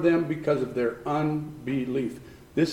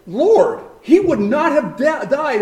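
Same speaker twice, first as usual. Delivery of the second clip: Lord, he would not have died, pitched higher, an aching reproach; as if Mary pleads in her heart, a touch raised; inner plea; 7 semitones higher, 3 dB louder than usual. Retold or imitated. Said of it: "in her heart".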